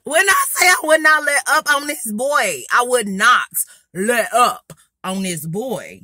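loud, high, then slowing for emphasis; loud emphatic insistence. Real, not acted. Storyteller stressing she would not let up, loud emphatic delivery. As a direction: belt it out, hammering each word. Emphatic boast about relentless roasting.

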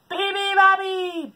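slow, loud bellow. Acted. Bears wake the bird, bellowing. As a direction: bellow it long. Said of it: "bellowed the bears".

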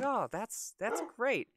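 high, loud, pleased thanks; grateful old man. Acted. Old man getting to borrow the razor, grateful. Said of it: "And he's like".

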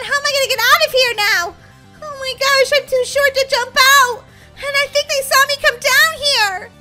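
high, urgent, wailing worry; panicked child. Acted. Girl stuck in a grave hiding from wolves, panicked.

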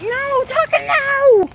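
high, rising cry of alarm at the dog. Real, not acted. Owner calling out to her dog in alarm. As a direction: stop the dog in a startled panic.

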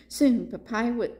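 ordinary delivery, nothing marked

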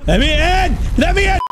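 high, loud shriek; escalating demand. Acted. Player locked out of the room, shrieking to get in.

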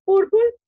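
"Purple" alto y fuerte, bien marcado; "Purple" pronunciado claro. Shown as a demonstration. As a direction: recalca "Purple" para que lo repitan. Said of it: "Es para pronunciación". (modelo de pronunciación de "Purple")

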